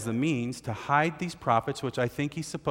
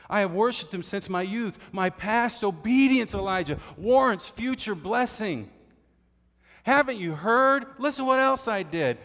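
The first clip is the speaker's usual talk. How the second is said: desperate pleading; 8 semitones higher than usual. Retold or imitated. Obadiah begging Elijah to let him hide, hammed-up. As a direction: plead as if your life hangs on it. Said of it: "He said".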